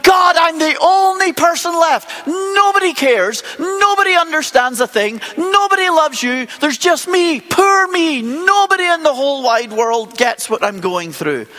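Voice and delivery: hammed-up poor-me lament, pitched high; whiny complaint. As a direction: Elijah complaining to God, mock self-pitying wail. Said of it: "he starts complaining to God and he's going".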